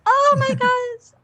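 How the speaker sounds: high, slow gasp of delight; amazed. Real, not acted. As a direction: react in sheer amazement. Amazed reaction to impression.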